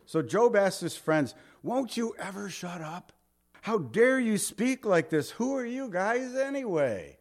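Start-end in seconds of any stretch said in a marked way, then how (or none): 1.6-7.2 high-pitched exasperated protest; acted indignant rebuke. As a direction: rebuke your friends in mock outrage. Acts out Job rebuking his friends.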